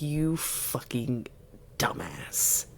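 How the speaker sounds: spitting a quiet gleeful insult; hushed insult. Retold or imitated. Host voicing Rhys insulting Tamlin, softly whispered. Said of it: "he was so excited to be like".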